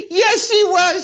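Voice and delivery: high, tearful laughing; real laughing fit; laughing hard. Real, not acted. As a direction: laugh until it squeaks.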